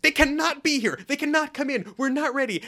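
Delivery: high, loud, frantic mock yelling; imitating a yell. Wife yelling at husband that guests can't come in, high mock panic. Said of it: "She's still yelling at him".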